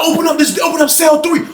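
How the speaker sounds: high shouted order; shouted demand to open the cell; retold shout. Someone shouting on intercom.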